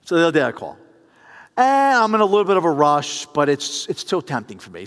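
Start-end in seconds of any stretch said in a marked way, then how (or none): none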